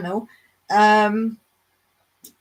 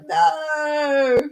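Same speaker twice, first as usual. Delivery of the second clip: higher, stretched-out mock no; drawn-out refusal; 6 semitones higher, 4 dB louder than usual. Gardener retelling her answer to an olive volunteer, drawn-out refusal. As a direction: refuse in a raised, long-held voice. Acted reply to a visitor's request.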